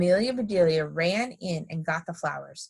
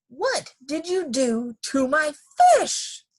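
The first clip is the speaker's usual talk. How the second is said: shouted demand; 10 semitones higher, 4 dB louder than usual. A narrator's reading. Mister Rogers to Amelia Bedelia about his fish, high outraged shout. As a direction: spell out the question word by word, up high. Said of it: "he shouted".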